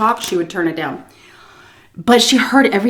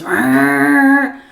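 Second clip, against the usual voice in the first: mock growl; 6 semitones higher, 4 dB louder than usual. Driver revving into reverse.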